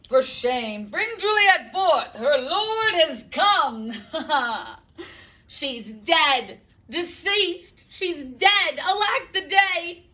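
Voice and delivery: loud, high, outraged grieving father; shouting father. Acted. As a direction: bellow the news of her death. Capulet storms in, grieving.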